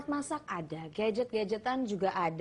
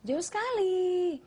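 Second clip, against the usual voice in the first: drawn-out, high amazed reaction; 8 semitones higher, much slower than usual. Real, not acted. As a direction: stretch it out in wide-eyed surprise. Host reacts to low price.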